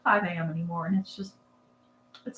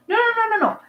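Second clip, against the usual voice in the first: rapid high-pitched no no no; high protest; 4 dB louder, faster than usual. Real, not acted. High playful protest at a viewer's comment. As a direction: squeal a playful denial.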